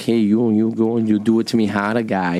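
hammed-up New York tough talk; New York accent. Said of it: "I could do a New York accent".

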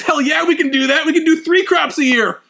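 high-pitched mock enthusiasm; gung-ho yes-man. Eager cadre seeking promotion, mock gung-ho boasting. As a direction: promise it eagerly, hammed up.

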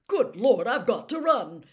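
loud, sudden exclamation of alarm. A narrator's reading. Bagman hears the whistle and must dash, alarmed. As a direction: burst out as if suddenly late. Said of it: "said Bagman in alarm".

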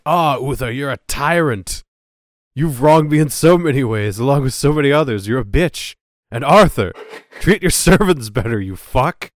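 loud ranting tirade; going off. Retold or imitated. Disguised Merlin telling off Uther and Arthur in a loud rant. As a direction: let rip at the king and prince. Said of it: "He just goes off".

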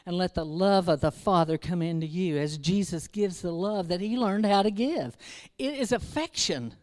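fervent preaching. Acted. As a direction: press it home to the congregation. Preaching builds to full force.

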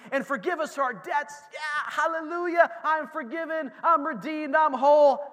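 loud, high shouts of praise; full-force preaching. Acted. Preacher firing up the congregation, loud and high. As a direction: whip the church into revival.

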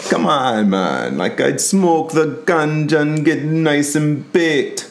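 a bad Jamaican impression, ham it up; horrible Jamaican accent. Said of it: "I know, I do horrible accents".

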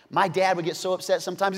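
normal talking voice

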